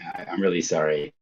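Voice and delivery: normal talking voice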